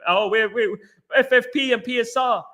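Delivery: high-pitched mock goody-goody voice. Mocks the club's timid excuse.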